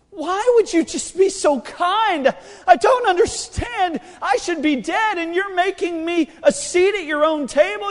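loud, high-pitched, bewildered outburst; Mephibosheth. Acted. Mephibosheth questioning David over his kindness, loud bewildered disbelief. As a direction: cry out in disbelief at undeserved kindness. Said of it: "he looked at David and says".

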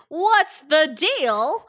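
Seinfeld impression, drawn out. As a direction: stretch it like a stand-up setup. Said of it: "what's he like".

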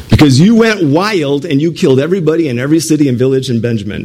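ordinary talk

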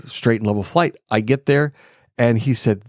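ordinary talk, nothing marked

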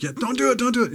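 high, urgent "don't do it"; pleading viewer. Retold or imitated. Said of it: "but you're just like".

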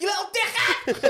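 high-pitched yelled insult. Acted. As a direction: yell it, voice shooting right up. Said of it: "I started raising my voice".

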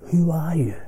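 breathy whispered question. Real, not acted. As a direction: whisper it as if asking inside. Rhetorical question to listener.

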